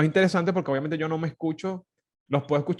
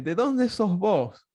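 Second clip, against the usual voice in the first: high, slow, curious Argentine woman; drawn-out, high-pitched question from a stranger; 6 semitones higher, 3 dB louder, much slower than usual. Retold or imitated. Argentine on the train asks where he's from, high and slow. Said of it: "y nos dice".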